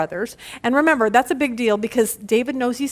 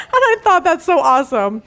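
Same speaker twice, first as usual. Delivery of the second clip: delighted; 4 semitones higher, 5 dB louder than usual. Real, not acted. Delighted by Dan's joke.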